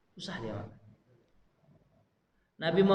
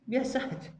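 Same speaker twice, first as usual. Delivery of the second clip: higher, rising and offhand; quick mock brush-off; 9 semitones higher than usual. Preacher repeats the careless man's shrug, brisker. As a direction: toss it off lightly.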